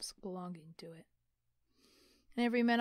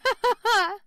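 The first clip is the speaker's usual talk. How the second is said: loud, high ringing laugh; nephew's booming laugh; hearty laugh; 12 semitones higher, 16 dB louder than usual. Acted. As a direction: laugh out big and open. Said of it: "laughed Scrooge's nephew".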